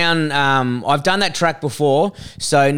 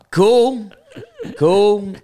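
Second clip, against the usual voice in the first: awkward cool; 6 semitones higher than usual. Retold or imitated. Retold awkward reply to heckler.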